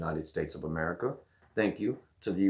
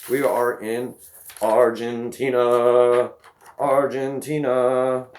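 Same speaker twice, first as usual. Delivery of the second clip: stretched slow, loud, higher announcement of the country; drawn-out hype call; 4 semitones higher, 9 dB louder, much slower than usual. Real, not acted. Vlogger hyping viewers in Argentina, slow loud drawn-out call. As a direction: belt the name out long like a stadium call.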